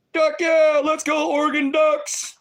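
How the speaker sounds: high, loud, slow stadium cheer; mock cheer. Streamer mock-cheering for the Oregon Ducks, loud high whoop. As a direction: whoop it out like a fan in the stands.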